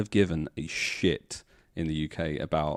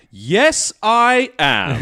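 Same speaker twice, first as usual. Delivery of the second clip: proud loud yes; 13 semitones higher, 10 dB louder, much slower than usual. Retold or imitated. Proudly declaring himself British.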